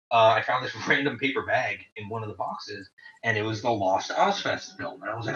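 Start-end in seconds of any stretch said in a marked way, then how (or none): none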